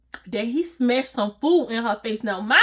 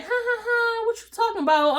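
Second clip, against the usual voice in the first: high giggly phone laugh; 10 semitones higher than usual. Retold or imitated. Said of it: "I'm on the phone like".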